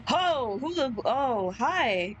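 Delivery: usual speaking voice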